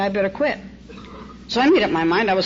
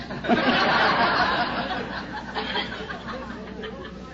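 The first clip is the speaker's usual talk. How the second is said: soft unvoiced laugh, rising; a quiet chuckle at the memory; breathy laugh; 5 semitones higher, 5 dB quieter than usual. Real, not acted. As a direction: laugh at yourself under your breath. Laughs at her own story.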